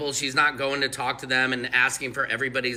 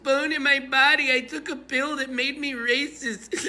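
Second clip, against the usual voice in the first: much higher, whiny denial voice; mock Karen; 10 semitones higher than usual. Vlogger mocking every Karen, high whiny denial with a laugh. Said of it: "every Karen is like".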